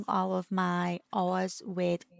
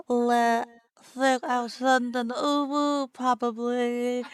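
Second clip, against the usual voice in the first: mock French accent; 2 semitones higher, 6 dB louder than usual. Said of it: "They recommended French".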